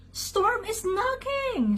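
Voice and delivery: very high, drawn-out sheep wail; high-pitched, stretched alarm cry; cried in alarm. A narrator's reading. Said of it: "the sheep cried".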